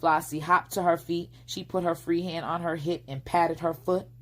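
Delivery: plain reading voice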